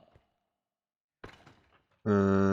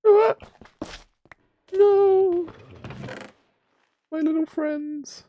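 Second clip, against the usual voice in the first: dismayed wail; much slower than usual. Real, not acted. Pets dying, real dismay.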